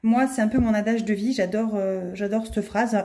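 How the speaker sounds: normal talking voice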